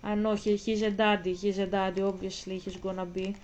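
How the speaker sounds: ordinary delivery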